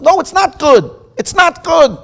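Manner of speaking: loud, high self-reproach; a traveller realising his mistake, loud and high; anguished outburst. Acted. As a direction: cry out that it is not good. Inner panic of traveller.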